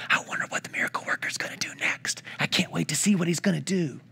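whispering crowd gossip, fast. Retold or imitated. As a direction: lean in and whisper eagerly to your neighbour.

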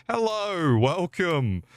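high, sugary customer-service voice; fake cheerful cashier. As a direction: switch on a bright fake-polite welcome. Fake-sweet cashier greeting customers.

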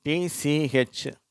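டி சி H spelled very slowly letter by letter; teacher spelling tch. Shown as a demonstration. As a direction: spell the letters out one at a time. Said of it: "முதலாவது".

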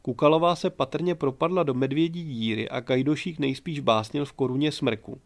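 usual speaking voice

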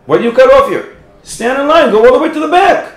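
high, loud indignant shouting; mock outraged crowd shout; crowd yelling. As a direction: yell at the line-cutter to get back. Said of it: "And people yelled at him".

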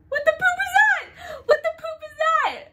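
high shrieking fright; scared shriek. Real, not acted. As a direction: scream it in real fear. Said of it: "I'm so scared".

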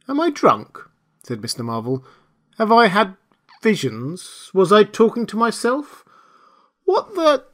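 high-pitched, bewildered questioning; a tramp doubting his own senses, pitched high. A narrator's reading. Marvel to himself after hearing a voice, bewildered.

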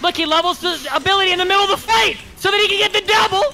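hype announcer. Pretend. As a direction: announce it like a big play. Mock hype commentary.

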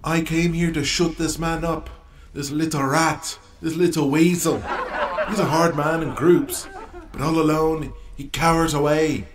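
mocking wrestler promo, laughing at his foe; cocky wrestler. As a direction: sneer at the rival like a showboating heel. Cocky wrestler at press conference.

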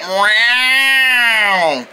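long drawn-out yowl; imitated creepy meow. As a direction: mimic a creepy cat's meow. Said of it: "It sounded like you said".

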